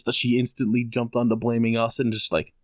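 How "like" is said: normal talking voice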